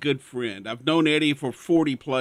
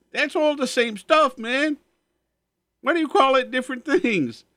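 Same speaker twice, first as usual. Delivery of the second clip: much higher, louder exasperated protest; exasperated friend; 11 semitones higher, 4 dB louder than usual. Retold or imitated. Friend Eddie protesting the menu in a high exasperated voice. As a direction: squeak out the friend's disbelief.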